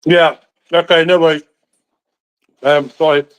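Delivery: talking through a mouthful of toast; mouth full of peanut butter. Real, not acted. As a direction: mouth full, words round a bite. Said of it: "Peanut butter on toast in my mouth".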